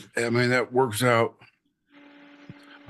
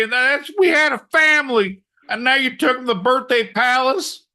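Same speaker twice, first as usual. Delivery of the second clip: hammed-up outrage; 5 semitones higher than usual. Ranting at the lawyers in hammed-up outrage. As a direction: rant it like a wronged father.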